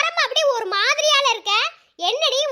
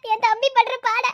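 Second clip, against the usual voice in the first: ordinary talk, nothing marked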